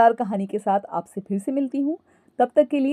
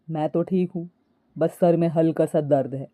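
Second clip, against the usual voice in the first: low, subdued male character voice; husband; 6 semitones lower than usual. A narrator's reading. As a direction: answer in a lowered husband's voice. Narrator voices husband with headache. (पति की नीची, दबी आवाज़)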